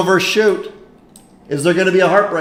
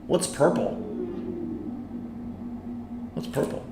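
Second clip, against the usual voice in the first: whisper; 14 dB quieter than usual. Real, not acted. Streamer asks quietly mid-race.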